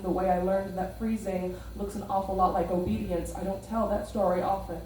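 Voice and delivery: measured spoken word delivery; hushed raw poetry. Acted. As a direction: lay each line down deliberately. Performs spoken word poem.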